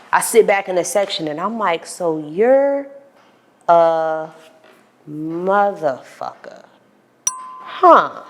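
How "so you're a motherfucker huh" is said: slow pondering. Retold or imitated. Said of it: "deep methodical thought".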